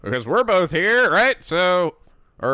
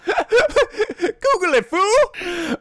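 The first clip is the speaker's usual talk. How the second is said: laughing taunt; 5 dB louder, much slower than usual. Acted. Character mocking laugh.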